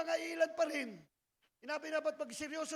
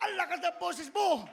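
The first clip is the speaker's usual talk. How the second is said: loud, laughing jab; laughing tease; 3 semitones higher, 5 dB louder than usual. Real, not acted. Preacher teasing a loud church member, laughing. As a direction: laugh as you tease them.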